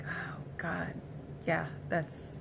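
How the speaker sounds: hushed realization; breathy whisper of awe. Retold or imitated.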